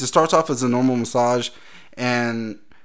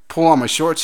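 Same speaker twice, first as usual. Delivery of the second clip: usual speaking voice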